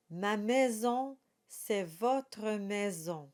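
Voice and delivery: slow, careful recital of French verse; poem recitation. Shown as a demonstration. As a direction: read each line like a poem, unhurried. Recites song lyrics slowly.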